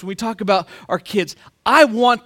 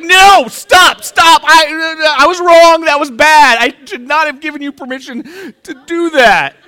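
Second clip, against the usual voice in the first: higher, loud shouting stop; panicked shout; 8 semitones higher, 9 dB louder than usual. Retold or imitated. Dad yelling at his swearing six-year-old to stop, loud and alarmed. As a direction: yell it in sudden alarm. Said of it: "I was like".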